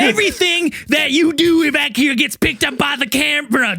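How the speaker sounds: high-pitched mock scolding; Zell. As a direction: imitate someone telling off the crew, voice pushed way up. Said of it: "I thought it was Zell yelling at us".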